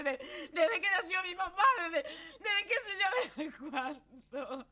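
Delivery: high, loud, laughing and crying at once; euphoric outburst. Real, not acted. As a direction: pour out years of held-in rage. Relives cathartic shouting, laughing, crying. (desahogo eufórico entre risas y llanto)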